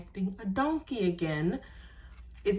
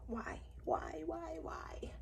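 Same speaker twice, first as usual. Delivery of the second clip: soft whispered why, over and over; despairing whisper; 8 semitones higher, 14 dB quieter, much slower than usual. Real, not acted. Tired late at night, begging the printer in a whisper. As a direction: plead quietly to the printer.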